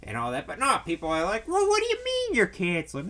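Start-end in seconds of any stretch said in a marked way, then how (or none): 1.5-3.1 high-pitched mock outrage; squawky put-on disbelief; mock indignant. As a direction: imitate an incredulous complainer, pitched way up. Mimics people upset at cancellations.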